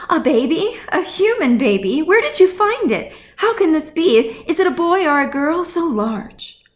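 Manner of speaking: high, bright chorus of eager questions; excited widows. Acted. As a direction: pile on the questions, thrilled and amazed. Widows bombard him with questions.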